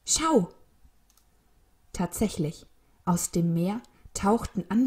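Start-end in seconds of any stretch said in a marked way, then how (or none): 0.1-0.5 high excited cry; high, urgent exclamation; sudden call. A narrator's reading. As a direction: call someone's attention at once. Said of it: "rief Elli plötzlich".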